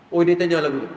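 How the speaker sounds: ordinary talk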